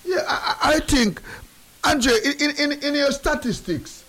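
high breathy laugh through words; giggling while speaking. Real, not acted. Laughs while asking Andre.

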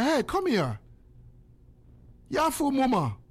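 put-on high-pitched call to a child. Calling a child over.

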